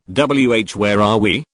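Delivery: usual speaking voice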